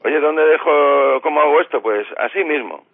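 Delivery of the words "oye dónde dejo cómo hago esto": puzzled asker. Pretend. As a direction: drag out the question like a confused person. Acted example of someone asking. (imitando a alguien despistado que pregunta, lento y más alto)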